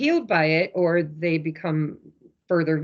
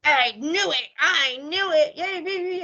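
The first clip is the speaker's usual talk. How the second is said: gloating win; 8 semitones higher than usual. Retold or imitated. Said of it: "he's like".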